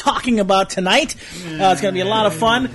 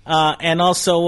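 ordinary talk, nothing marked

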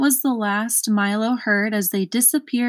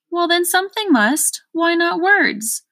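much higher, louder triumphant exclaim, slowing on the end; 9 semitones higher, 4 dB louder than usual. A narrator's reading. Undersecretary winning the argument, high and triumphant. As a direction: crow it like a winning point. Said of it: "exclaimed the undersecretary triumphantly".

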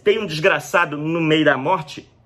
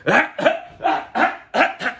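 imitated spraying cough; 9 semitones higher than usual. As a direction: cough it out in every direction. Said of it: "Ele dá uma tossida assim".

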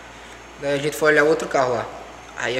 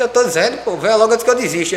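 insistent retold friend; 6 semitones higher, 4 dB louder than usual.

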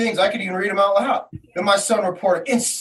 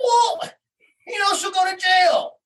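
sarcastic retort; 10 semitones higher than usual. Retold or imitated. Father to the police, mocking sarcastic shout. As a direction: throw their excuse back at them.

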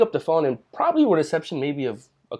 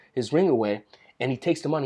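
ordinary talk

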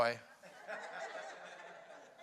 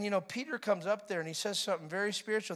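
ordinary delivery

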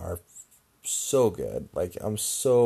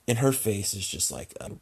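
usual speaking voice

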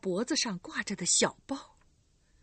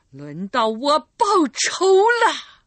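slow, loud and higher; vengeful declaration; 6 semitones higher, 12 dB louder, slower than usual. Acted. Recluse to the girl, slow loud vengeance. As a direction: spit out each word of revenge.

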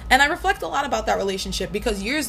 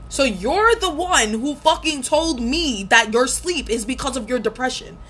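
higher, slower, heated accusation; indignant rant; 4 semitones higher, 3 dB louder, slower than usual. Real, not acted. Venting at her ex over who gaslighted whom, heated and slow. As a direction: throw it back at him, exasperated.

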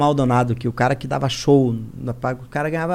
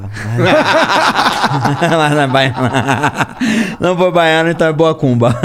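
laughing; 6 dB louder, slower than usual. Real, not acted. Joking about macumba, laughing.

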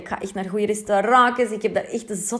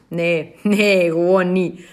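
ordinary talk, nothing marked

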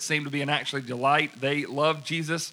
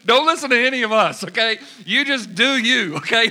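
much higher and louder, pressing her; emphatic urging; 7 semitones higher, 7 dB louder than usual. Retold or imitated. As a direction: plead with her not to copy them. Urging her to keep going.

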